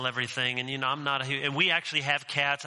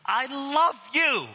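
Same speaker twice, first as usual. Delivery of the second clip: high, slow and eager like a bounding dog; a dog overjoyed to see you; 9 semitones higher, much slower than usual. Retold or imitated. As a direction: gush it out like an excited puppy. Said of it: "He's like".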